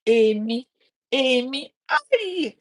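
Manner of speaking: exclamation of hurt climbing high; acted parental lament. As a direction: let the hurt cry rise to a high "aye". Acts parent's wounded exclamation again.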